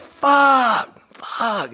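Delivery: very high, drawn-out scream of pain; high pained wail, acting it out; re-enacted pain scream. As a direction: scream the curse up high, imitating agony. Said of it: "I started screaming like a bitch".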